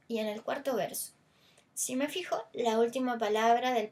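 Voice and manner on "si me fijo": ordinary talk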